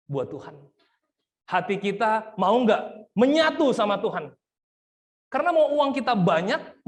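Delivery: preacher rebuking the crowd, high and loud; impassioned preaching. Acted. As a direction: hammer the point to the congregation. Preaching at full force.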